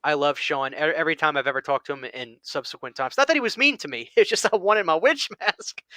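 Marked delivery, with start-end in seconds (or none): 3.2-5.8 laughing. Real, not acted. Laughing while joking about mask.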